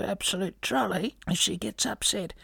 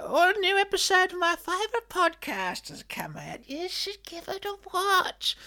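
excited friend recommending; 10 semitones higher than usual. Retold or imitated. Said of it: "Tell them".

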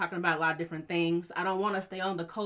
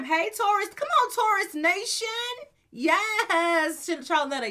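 excited shout-out; 11 semitones higher, 5 dB louder than usual. Real, not acted. Shouting out listeners in the chat.

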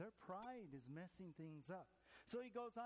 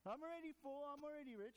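smug mockery; 7 semitones higher, faster than usual. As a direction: puff up and crow it. Mocking smug churchgoers.

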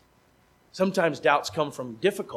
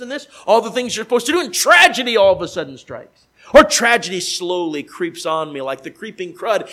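ordinary talk, nothing marked

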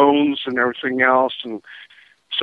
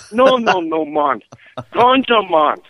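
higher mock Jamaican insisting; Jamaican accent; 5 semitones higher, slower than usual. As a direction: insist like the beach hustler.

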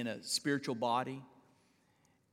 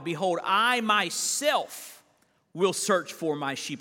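full-force preaching; 4 semitones higher, 7 dB louder than usual. Acted. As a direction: proclaim the verse like a preacher. Preacher reads scripture with force.

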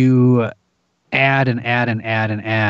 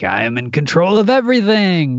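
crazed control freak; 6 semitones higher than usual. Retold or imitated. Narrator mocking a crazed power-hungry person, lightly hammed. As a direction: playfully ham up a power-mad person. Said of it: "that crazed person that's like".

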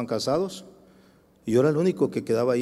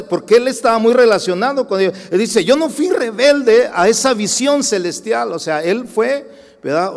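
loud, high preaching to the congregation; forceful preaching; 9 semitones higher, 8 dB louder than usual. Acted. Preacher to congregation, loud and forceful.

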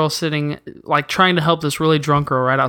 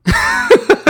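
loud laugh; 5 dB louder than usual. Real, not acted. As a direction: burst of high laughter. Laughs at own story.

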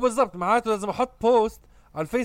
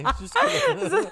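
laughing drawn-out question; 3 dB quieter, much slower than usual. Real, not acted. Joking about working from home.

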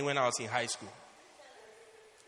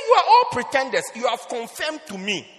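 accusing shout; 6 semitones higher, 9 dB louder than usual. Retold or imitated. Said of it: "I said".

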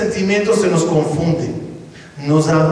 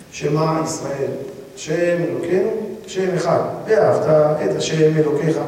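hushed prayer; 4 semitones lower, 4 dB quieter than usual. Acted. Jacob praying the Shema while embracing his son, hushed. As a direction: recite the Shema softly, devoutly.

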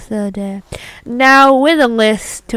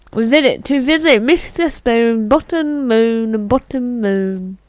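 usual speaking voice

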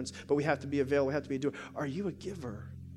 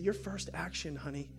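soft, unhurried pastoral encouragement; tender counsel; 8 dB quieter than usual. Retold or imitated. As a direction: speak gently, as if consoling one person. Retold counsel to crying woman.

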